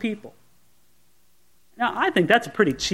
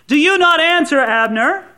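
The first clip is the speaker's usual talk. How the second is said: calls across the valley, higher and louder; calling out; 7 semitones higher, 4 dB louder than usual. Acted. David calling from the hilltop to Abner, loud. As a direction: shout it over to the enemy camp.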